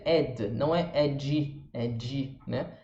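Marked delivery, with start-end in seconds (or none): 0.0-1.7 Ed with an open E and a barely touched D; Ed, not edi, with no vowel after the D; light final D. Shown as a demonstration. Teacher correcting how students say Ed, slowly. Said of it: "esse Dzinho também bem leve".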